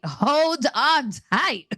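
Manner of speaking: drawn-out, high comic warning; playful mock warning. Reader bracing listeners for hard names, playful drawn-out warning.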